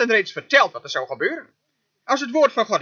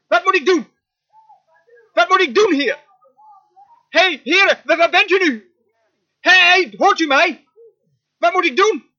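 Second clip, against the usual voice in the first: loud, high, insistent calling; crying out; 7 semitones higher, 5 dB louder than usual. Acted. Moses demands an answer from the Lord, crying out. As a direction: holler up to a God who seems not to hear. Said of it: "hier riep hij het uit".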